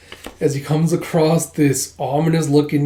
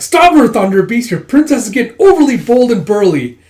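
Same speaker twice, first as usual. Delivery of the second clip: much higher, louder call to stop her; alarmed shout; 8 semitones higher, 8 dB louder than usual. Acted. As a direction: yell in mock alarm. Voicing an alarmed character.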